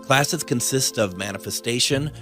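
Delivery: smooth commercial voiceover; ad read. Acted. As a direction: sell it like a radio spot. Recorded ad read.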